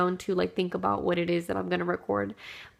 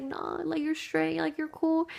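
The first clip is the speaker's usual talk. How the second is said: put-on higher, easygoing kid voice; boy brushing off her apology, higher and easy; lightly voiced little boy; 8 semitones higher than usual. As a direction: shrug it off like a forgiving boy. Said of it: "He was like".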